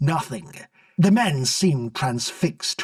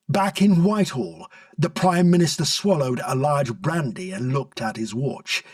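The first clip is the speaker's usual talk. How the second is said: ordinary delivery, nothing marked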